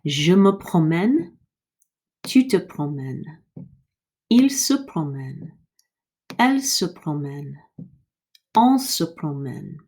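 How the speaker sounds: Je me promène with open eh in promène; model conjugation. Shown as a demonstration. Teacher to class, careful model pronunciation. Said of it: "So it will sound like this".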